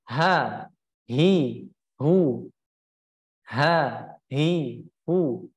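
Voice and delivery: "ha, hi, hu" with the voice released, not held back; flowing sound. Shown as a demonstration. Teacher demonstrates unblocked sound, flowing drill. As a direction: let sound flow out on "ha", "hi", "hu". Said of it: "tidak tertahan suaranya".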